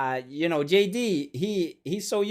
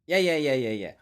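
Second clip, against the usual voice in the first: ordinary delivery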